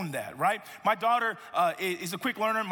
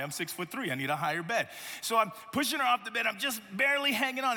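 usual speaking voice

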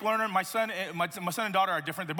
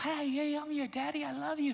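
put-on soft, higher-pitched baby talk; playful, hushed daddy voice up high; doting daddy baby talk; 5 semitones higher, 5 dB quieter than usual. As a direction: coo it gently to a baby. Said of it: "And I'm like".

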